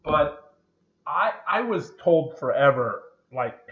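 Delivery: normal talking voice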